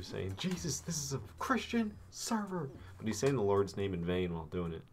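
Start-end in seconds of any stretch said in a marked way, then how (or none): 0.4-2.7 a light high mimic of a yelling friend; mock yell. Retelling a friend's yell to the stream, lightly mocking. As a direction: squeak out the friend's outburst. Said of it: "Knight's yelling at you too".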